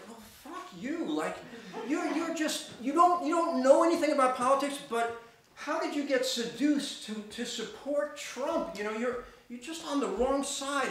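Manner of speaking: high, loud, fed-up outburst; exasperated rant. Retold or imitated. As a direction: vent at him as if he stood right there. Venting at the Trump supporter.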